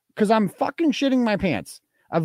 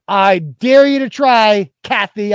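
taunting; 5 semitones higher, 4 dB louder, slower than usual. Real, not acted. Taunting the caller's wife.

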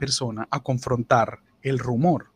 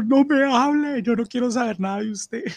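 whiny offended coworker; 11 semitones higher, 4 dB louder than usual. Retold or imitated. Crying coworker refusing to talk to the prankster, high and whiny.

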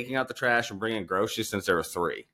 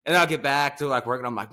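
normal talking voice

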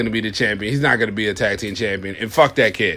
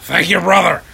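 high, loud wrestling promo shout; 7 semitones higher, 5 dB louder than usual. Acted. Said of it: "enough of the voice".

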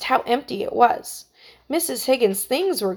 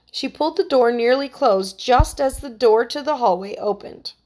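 usual speaking voice

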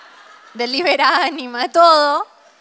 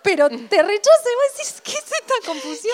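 indignant high rant; 9 semitones higher than usual. Real, not acted. Indignada explica al rechazador.

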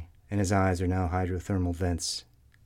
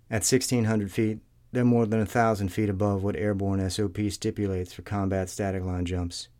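ordinary delivery, nothing marked

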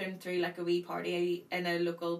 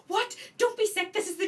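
high, loud panicked yell from mum; mother yelling at her daughter about to be sick in the new car; alarmed mum; 12 semitones higher, 6 dB louder than usual. Retold or imitated. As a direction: shriek it like a horrified mother. Mum reacts to vomiting threat.

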